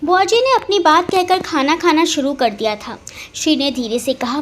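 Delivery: ordinary delivery, nothing marked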